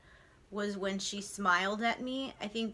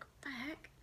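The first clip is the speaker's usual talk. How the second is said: whispering; 9 semitones higher, 5 dB louder than usual. Retold or imitated. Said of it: "I was like".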